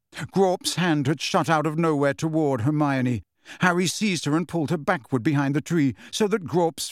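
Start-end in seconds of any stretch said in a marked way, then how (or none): none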